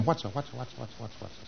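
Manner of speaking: very soft, fading repetitive mutter; muttering preclear. Acted. Client puzzles over the question, muttering softly. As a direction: repeat it under your breath until it fades away. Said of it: "He's saying".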